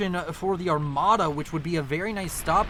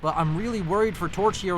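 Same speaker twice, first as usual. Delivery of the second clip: ordinary talk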